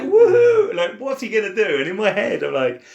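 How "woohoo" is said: high, loud mock whoop of victory. Imagined Jesus returning to disciples, triumphant mock whoop.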